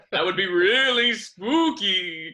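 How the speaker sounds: slow, drawn-out, laughing; spooky line through a laugh. Pretend. Jokey spooky remark, laughing.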